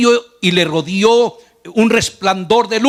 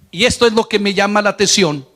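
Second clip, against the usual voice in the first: usual speaking voice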